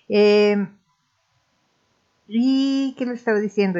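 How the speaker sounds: ordinary talk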